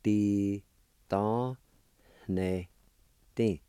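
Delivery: "Ti ta ne ti" one syllable at a time; sounded out. Shown as a demonstration. Teacher showing how gifts is pronounced, sounded out. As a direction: leave a clear pause after each of "Ti ta ne ti". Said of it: "It's pronounced like this".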